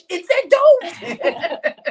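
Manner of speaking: high, drawn-out squeal through laughter; mock startled cry. Retold group's startled reaction.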